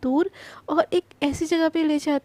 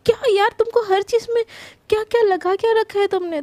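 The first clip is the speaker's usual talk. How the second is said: higher, louder exasperated scold; 6 semitones higher, 4 dB louder than usual. Acted. Krishna scolding Prachi over the wedding idea, exasperated and loud. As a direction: tell her off in exasperation.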